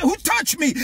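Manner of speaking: high-pitched demand to know; startled question. Acted. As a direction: ask it high, stopped short. Jesus asks the crowd.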